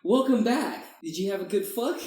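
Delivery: high, loud cheeky host voice; laughing imitation of a comic; mock comedian to camera. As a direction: address the camera like a talk show host. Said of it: "he looks at the camera and goes".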